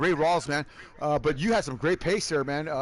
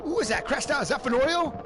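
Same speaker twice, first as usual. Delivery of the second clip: excited play-by-play; 6 semitones higher than usual. Acted. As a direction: shout out the crash as it happens. Calls a wreck live.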